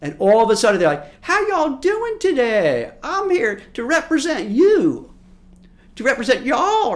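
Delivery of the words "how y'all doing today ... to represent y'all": loud, high, drawn-out mock Southern drawl; hammed-up politician's Southern accent, slow and booming; fake Southern accent. Northern politician pandering down south, fake Southern accent. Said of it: "coming with this Southern accent".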